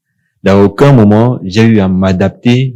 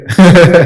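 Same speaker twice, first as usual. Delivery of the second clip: burst of laughter; 10 semitones higher, 8 dB louder than usual. Real, not acted. Laughs at accent joke.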